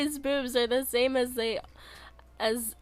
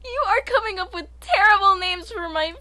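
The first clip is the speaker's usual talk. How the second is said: ordinary talk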